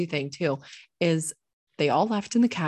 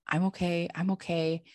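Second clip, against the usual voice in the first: ordinary delivery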